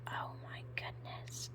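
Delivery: breathless whisper of awe; awed whisper. Real, not acted. Vlogger whispering to viewers in awe. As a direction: whisper it, amazed by the colours.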